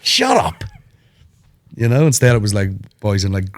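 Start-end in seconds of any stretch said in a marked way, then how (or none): 0.0-0.6 high snotty brush-off; movie bully. Retold or imitated. Mocking a Hollywood bully telling someone off, high snotty voice. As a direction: snap it like a sneering teen bully. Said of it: "you just be like".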